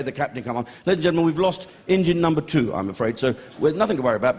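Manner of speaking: usual speaking voice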